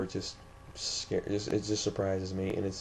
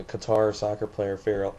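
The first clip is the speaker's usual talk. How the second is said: ordinary talk, nothing marked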